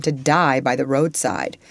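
plain reading voice

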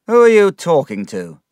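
insolent retort. A narrator's reading. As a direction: throw it back at him. Insolent pupil answers teacher back.

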